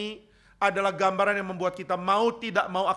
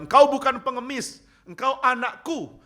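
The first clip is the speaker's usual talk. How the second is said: higher, louder proclamation, slowing on the second phrase; God speaking lovingly; 8 semitones higher, 8 dB louder than usual. Acted. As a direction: declare it as God calling his child. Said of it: "Dan Tuhan akan bilang". (suara Tuhan yang lantang penuh kasih)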